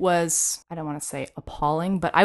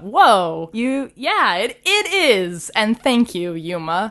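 surprised; 4 semitones higher, 5 dB louder, slower than usual. Retold or imitated. Touched reply to student.

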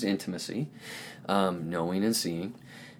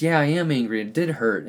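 acted child's anger, higher and louder at first; mildly angry child; 6 semitones higher, 5 dB louder than usual. Child opening up to parent about hurt, mildly angry. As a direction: admit the anger with emphasis, imitating a child. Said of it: "starts to feel that anger and says".

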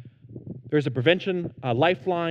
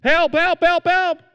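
high, loud cries of help, like a man drowning; retold yell for help; 11 semitones higher, 8 dB louder, slower than usual. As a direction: shout help over and over as if drowning. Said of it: "and he yells".

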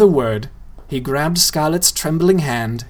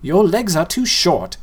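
put-on boy's voice; 5 semitones higher than usual. Boy's voice in story.

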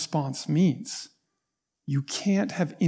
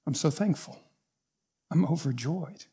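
1 quiet joy; 5 dB quieter than usual. Retold or imitated. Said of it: "And he says".

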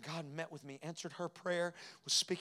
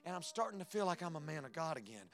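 higher and louder, building testimony; full-force preaching; 6 semitones higher, 9 dB louder than usual. Acted. Preacher confessing his grief to the congregation, full-force preaching. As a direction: preach it out, climbing with each line.

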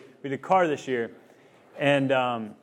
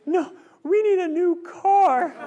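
put-on much higher, slow, whiny little girl; pouty toddler voice; 7 dB louder, much slower than usual. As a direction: stretch it out like a lonely little kid. Daughter demands new car.

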